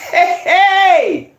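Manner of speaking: high, delighted cry; overjoyed exclamation; excited whoop. Real, not acted. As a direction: let out a thrilled, high cheer. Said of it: "I got all excited".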